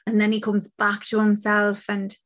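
usual speaking voice

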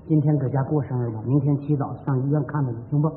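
subdued suggestion. Acted. Reconciled husband gently proposes plans.

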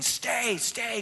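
lightly acted coach's call, pitched high. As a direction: call it like a coach holding a runner. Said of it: "the coach is saying".

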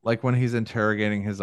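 ordinary talk

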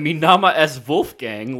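mock German accent. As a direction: mimic a co-host's bad German accent. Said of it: "with his German accent".

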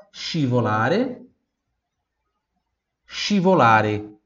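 scivolare said slowly, shi-vo-LA-re; slow Italian teacher model. Shown as a demonstration. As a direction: drag scivolare out for repeating. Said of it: "Repita em voz alta".

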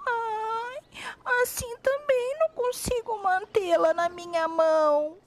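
put-on high, soft child's whine; little girl voice. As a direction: sigh it like a small girl. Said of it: "disse a menina".

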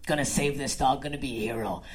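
hushed and low, a would-be hero psyching up; inner hero voice. Retold or imitated. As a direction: say it under your breath, self-important. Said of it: "I'm like".

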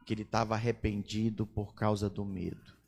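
ordinary talk, nothing marked

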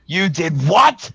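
incredulous yell. A narrator's reading. Director yelling in shock at the pendant, incredulous yell. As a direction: yell it, pitch climbing on the last word. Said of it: "she yells".

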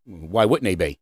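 put-on low, unbothered kid behind the counter; mock drive-thru worker's low reply. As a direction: drop it low as a clueless employee. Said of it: "the kid looks at you and he says".

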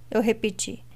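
ordinary delivery

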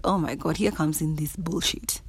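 low-volume weary aside; inner voice. Retold or imitated. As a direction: say it under your breath, as a private thought. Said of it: "In my head, I was like".

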